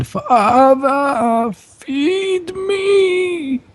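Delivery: very high, drawn-out call from afar; hungry undead son.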